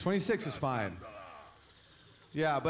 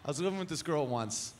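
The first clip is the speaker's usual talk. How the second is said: ordinary talk, nothing marked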